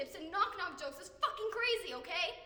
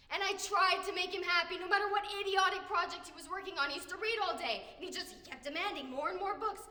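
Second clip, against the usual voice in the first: exasperated venting; 3 semitones higher, 5 dB louder than usual. Acted. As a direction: pour out the frustration loudly. Acted venting about demanding father.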